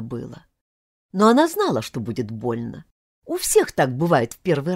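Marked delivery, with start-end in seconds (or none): none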